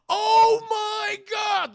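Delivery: high, drawn-out horrified scream; imitated horrified freak-out. Storyteller voicing shocked British couple, mock horrified scream. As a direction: scream it slowly, mimicking someone freaking out. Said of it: "The British couple is freaking".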